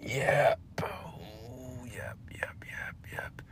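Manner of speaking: soft unvoiced yeps; whispered yeps. Real, not acted. After sighing about her, resigned whispered yeps. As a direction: breathe the words out, resigned.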